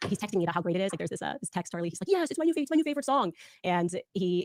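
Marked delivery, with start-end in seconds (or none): 2.1-3.3 high-pitched imitation of an excited friend; mock-giddy enthusiasm; delighted quote. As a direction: gush like a thrilled new fan. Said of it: "he just loved it".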